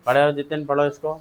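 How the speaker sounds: usual speaking voice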